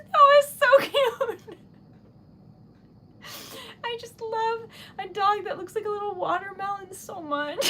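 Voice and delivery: high, loud, teary voice breaking into laughter; gushing while close to real tears; laugh-crying. Real, not acted. To her viewers about a round corgi, near real laugh-crying. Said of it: "Oh, I'm gonna cry".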